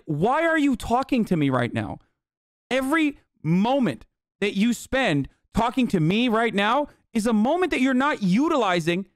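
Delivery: deliberate, emphatic exasperation rising in pitch; acted outrage. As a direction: act out an incredulous husband berating investigators. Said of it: "You know what I would say in this situation?".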